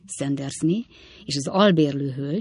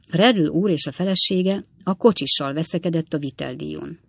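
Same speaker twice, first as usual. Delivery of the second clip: plain reading voice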